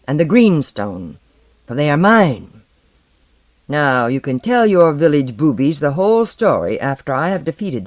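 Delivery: loud, haughty proclamation; arrogant sea lord. Acted. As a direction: announce your birthright grandly. Blackbeard proclaims himself.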